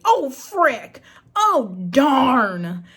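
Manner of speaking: lightly mock a character who won't curse; mock prim character. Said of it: "Instead, he would say".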